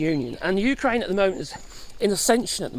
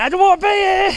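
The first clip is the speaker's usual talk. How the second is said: much higher, louder mock protest; mock Rubio whine; 11 semitones higher, 7 dB louder than usual. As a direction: voice the minister desperate to leave. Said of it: "Marco Rubio sitting there".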